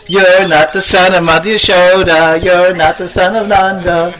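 mock him like taunting kids; teasing taunt. Boys irritating little Krishna, teasing. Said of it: "they were irritating Krishna".

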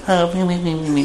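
high, small, soft city-lady voice; mimicked Delhi ladies. Delhi ladies visiting the village, mimicked in high soft voices. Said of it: "and Delhi ladies come".

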